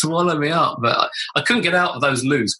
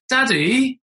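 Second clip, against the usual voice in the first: daughter calling; 10 semitones higher than usual. Retold or imitated. Daughter calling outside cubicle door.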